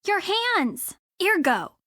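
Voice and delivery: normal talking voice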